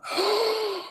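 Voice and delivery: sharp, high, hammed-up intake of breath; mock gasp. Streamer mocking the outrage over Sophie Turner, mock gasp. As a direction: fake a shocked gasp.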